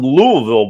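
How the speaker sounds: Louisville said the local way, LOO-uh-vul; local pronunciation. Shown as a demonstration. Cohost announcing his trip, Louisville pronounced LOO-uh-vul. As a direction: run Louisville together with a silent S, LOO-uh-vul. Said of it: "that's the way it's properly pronounced".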